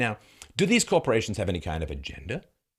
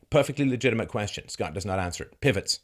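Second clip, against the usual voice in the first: usual speaking voice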